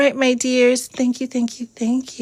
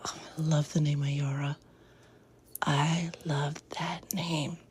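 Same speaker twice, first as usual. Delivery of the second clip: soft awed whisper, much softer; 5 semitones lower, 10 dB quieter than usual. Real, not acted. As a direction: whisper your delight to yourself. Admiring a viewer's name.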